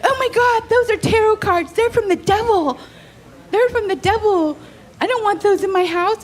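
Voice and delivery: hammed-up horrified churchgoer; panicked roommate. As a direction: freak out that the cards are evil. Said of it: "And she's like".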